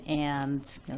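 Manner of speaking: ordinary talk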